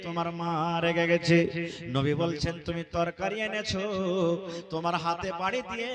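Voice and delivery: loud, slow, sing-song sermon chant; drawn-out, soaring preacher's cry; preaching at full force. Acted. Preacher chanting the Prophet's words to the congregation, loud and drawn out.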